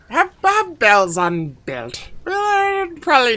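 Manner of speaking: slow broken-speech goblin voice; lightly voiced brute, slow and simple; stage whisper. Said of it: "assume for the sake of my voice that I'm whispering".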